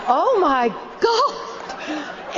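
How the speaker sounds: slow-motion alarm, retold; horrified realization. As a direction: stretch it out as disaster unfolds, mock horror. Horrified thought, bus in mid-air.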